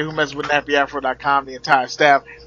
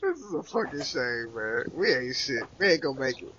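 mock despair; 12 dB quieter than usual. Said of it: "mock despair at the show".

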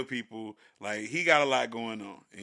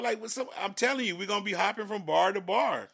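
high, exasperated defense of a night out; argumentative; 6 semitones higher than usual. Acted. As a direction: argue back, pitch raised. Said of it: "felt like an argument was ensuing".

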